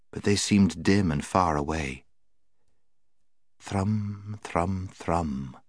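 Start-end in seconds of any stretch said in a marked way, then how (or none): 3.6-5.6 soft thrumming. A narrator's reading. Narrated instrument sound.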